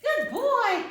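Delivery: put-on slow praising good boy, rising to high; drawn-out, then lifted praise. Shown as a demonstration. Trainer praising her dog during tug, warm. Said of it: "I'll use commands like good boy".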